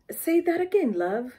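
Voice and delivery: high mock British accent on say that again; lightly put-on local Brit; imitating a British local. The local asks you to repeat, high mock British accent. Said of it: "when the local tells you".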